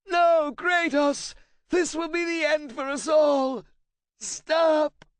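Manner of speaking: high, loud, desperate scream; terrified plea. A narrator's reading. Translator begging Kratos to stop, high loud terrified plea. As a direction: beg him to stop.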